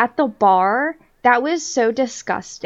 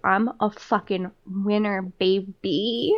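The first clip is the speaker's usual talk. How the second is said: lower, softer smug mock voice; smug winner; 3 semitones lower, 7 dB quieter, slower than usual. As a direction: purr it like a cocky winner. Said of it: "he's like".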